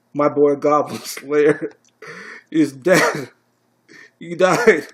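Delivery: loud, rising lament with a laugh slipping in; mock grief outburst. Reviewer mourns his fallen favorite hero to viewers, mock grief.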